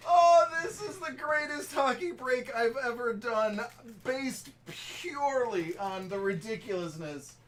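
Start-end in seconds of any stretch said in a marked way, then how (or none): 0.1-3.6 giddy. Real, not acted. Streamer still giddy after the pull.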